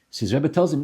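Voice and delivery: ordinary talk, nothing marked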